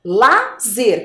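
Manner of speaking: "Lazer" broken as La, pause, zer; syllable by syllable. Shown as a demonstration. Teacher prompting children to name the syllable, syllable by syllable. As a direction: say "Lazer" in two separate syllables. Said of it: "Que sílaba é essa?".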